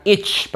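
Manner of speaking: "ich" with a hard tch; wrong itch. Shown as a demonstration. Teacher rejecting the reading, tch ending. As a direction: end "ich" like English itch. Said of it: "Ini bukan ich bacanya".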